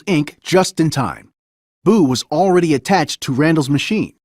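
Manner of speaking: usual speaking voice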